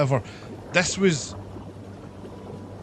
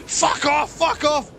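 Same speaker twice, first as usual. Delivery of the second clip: shrill-pitched retold yell; imitated screaming; 11 semitones higher, slower than usual. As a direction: imitate someone screaming as they flee. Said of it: "runs out the room screaming".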